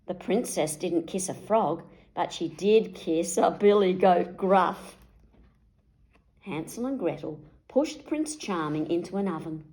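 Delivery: usual speaking voice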